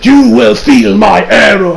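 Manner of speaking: slow, portentous stand voice; drawn-out theatrical proclamation; spirit voice. Acted. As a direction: pronounce it like an otherworldly judge. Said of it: "He's like".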